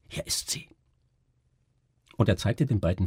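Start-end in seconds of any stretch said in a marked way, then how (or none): 0.1-0.7 very soft, voiceless whisper. A narrator's reading. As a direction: slip it over secretly, barely audible. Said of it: "flüsterte er". (leise geflüstert)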